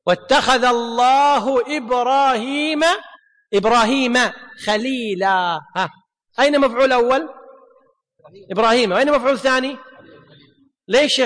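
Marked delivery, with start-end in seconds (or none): 0.1-5.6 Quranic recitation. Shown as a demonstration. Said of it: "Quran recitation".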